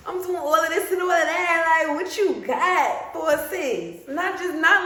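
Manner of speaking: loud, high, challenging call to God; bold shout. Retold or imitated. Praying to God and daring Him, loud and bold. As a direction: demand it straight up, no begging.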